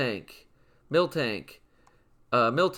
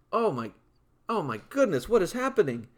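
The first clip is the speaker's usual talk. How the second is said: higher, startled oh my goodness; surprised; 7 semitones higher, faster than usual. Real, not acted. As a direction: react to the screen going wild. Sudden screen event.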